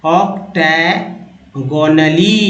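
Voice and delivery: octagonally drawn out syllable by syllable, very slow; slow teaching pronunciation of octagonally. Shown as a demonstration. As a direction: spell out octagonally slowly for learners. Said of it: "एक बार उच्चारण कर लीजिए".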